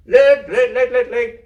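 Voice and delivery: high, loud sing-song call; calling the ducks. Acted. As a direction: call out to the ducks across the paddock. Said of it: "he called".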